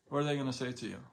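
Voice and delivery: ordinary talk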